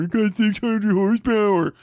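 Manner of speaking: hammed-up bragging.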